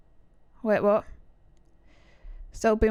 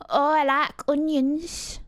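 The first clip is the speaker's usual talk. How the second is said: slow, higher mimicked Yorkshire accent; 5 semitones higher, slower than usual. Streamer imitating the girl from the clip, high slow Yorkshire. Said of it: "Listen to this accent".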